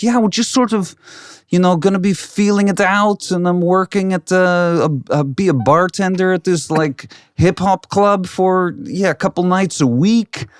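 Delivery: high-pitched imitation of a Dutch-accented English speaker. Imitating a friend's son describing his plans, high Dutch accent. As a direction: imitate a laid-back Dutch kid, pitched well up. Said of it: "this Dutch accent".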